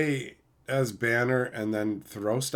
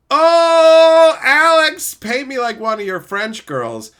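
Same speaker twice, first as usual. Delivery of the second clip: huge high wail easing into mock quote; mocking shout; 13 semitones higher, 13 dB louder, slower than usual. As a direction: cry out theatrically to tease him. Mockingly quotes the Super Chat.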